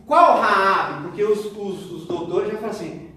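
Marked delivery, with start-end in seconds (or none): 0.1-1.0 louder, challenging question; hammed-up doubter asking which one; scornful mock query, loud; mock incredulous question. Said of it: "E aí pra ele fala assim".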